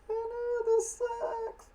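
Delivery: very high squeaky mock scream. Soldiers screaming in the background, squeaky and high. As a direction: shriek it in a tiny high voice. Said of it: "their screams in the background like".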